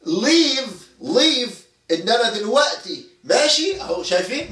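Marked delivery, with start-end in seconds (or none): 0.1-1.6 emphatic demo word. Shown as a demonstration. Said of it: "بنقولها كده".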